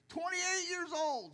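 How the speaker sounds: high, put-on voice of a self-pitying youngster; mock complaint. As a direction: parrot them with a mocking high voice. Mocking quote repeated.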